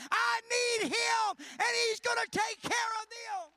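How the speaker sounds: loud, high, hammering each every day; preacher at full force, slowing on I need Him; shouted preaching. Acted. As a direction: build the sermon to its peak, shouting. Repetitive shouted preaching climax.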